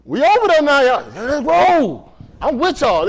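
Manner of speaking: loud, high rallying call; hyped up. Pretend. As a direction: pump the congregation up. Hyping the crowd comically.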